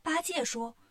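plain reading voice

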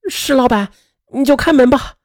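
high call through a closed door. A narrator's reading. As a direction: call to someone inside, resigned to it. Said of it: "朝着卫生间内就大声地说道".

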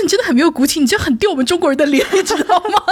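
laughing mock rebuke; mock scolding. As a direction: scold her for having no backbone. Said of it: "我就大骂他".

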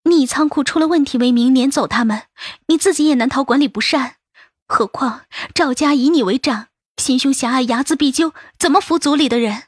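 rushed, pressing argument; fast and urgent. Acted. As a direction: rattle it off, anxious. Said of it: "杜恒说得又急又快". (又急又快地说)

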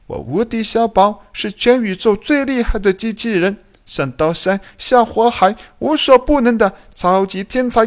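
high-pitched, cocky little robot in a huff; indignant cursing. A narrator's reading. Offended robot cursing an unseen old voice, indignant. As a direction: rant back angrily in a high, boastful voice. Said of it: "很是气愤的".

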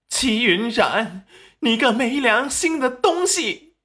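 high, loud tirade of abuse; furious yelling. A narrator's reading. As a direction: lash out at the daughter in outrage. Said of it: "立即大怒".

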